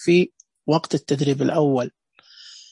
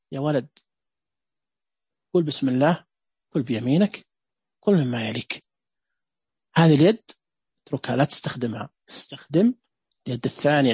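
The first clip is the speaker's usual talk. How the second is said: put-on lower, gentle coaxing to a child; with love and mercy; 5 semitones lower than usual. Shown as a demonstration. Teacher shows the loving way to teach a child to eat. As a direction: say it tenderly, like a loving parent. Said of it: "وممكن تؤدى بشكل حب ورحمة".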